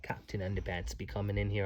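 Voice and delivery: plain reading voice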